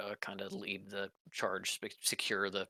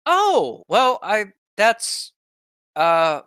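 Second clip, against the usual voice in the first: high, flustered stammering; 13 semitones higher, 15 dB louder than usual. Acted. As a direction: scramble for an answer. Elise flustered by bad news.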